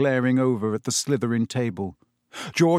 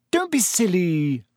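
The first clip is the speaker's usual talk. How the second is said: higher, slow, drawn-out dismissal; mildly impatient put-down; short; 5 semitones higher than usual. A narrator's reading. Hermione dismissing Ron's doubts, shortly. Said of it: "said Hermione shortly".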